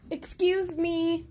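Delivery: ordinary delivery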